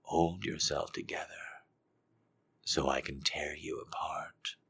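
soft, slow whispered threat; hushed seductive threat. Acted. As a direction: whisper the menace. Whispered dom quote.

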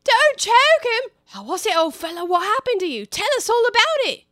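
high, loud hubbub of helpers; excited crowd voices. Acted. Animals crowding round Bill to revive him, loud and excited. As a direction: fuss over the fallen lizard. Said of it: "another confusion of voices".

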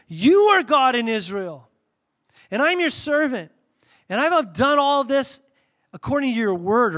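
loud, slow, fervent prayer; solemn prayer. Acted. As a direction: call out to God with full conviction. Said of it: "Let me read it to you".

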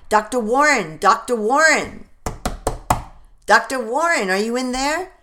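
loud urgent calling; calling out loudly. Acted. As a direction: shout through the door, urgent. Apprentice pounding on the door.

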